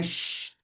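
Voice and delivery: long soft shhh on "X"; "X" as a held hush; sound of X. Shown as a demonstration. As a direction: sustain sh as in xícara. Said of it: "de xícara".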